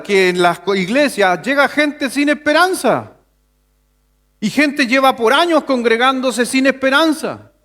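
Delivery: high-pitched, insistent sermon delivery; impassioned preaching. Real, not acted. Pastor telling the congregation about lost hope, impassioned preaching. Preacher builds to full force.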